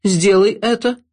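high queen's voice chiming in. A narrator's reading. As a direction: agree with a push. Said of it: "поддакнула королева".

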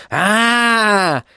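long, high theatrical "Aah!"; showman's drawn-out cry of greeting; loud drawn-out exclamation. A narrator's reading. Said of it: "громко воскликнул он".

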